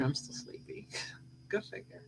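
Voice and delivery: unvoiced, drowsy murmur with a small laugh; hushed and sleepy; sleepy whisper. Real, not acted. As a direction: whisper it, still half asleep.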